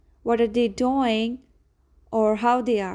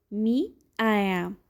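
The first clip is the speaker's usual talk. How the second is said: ordinary talk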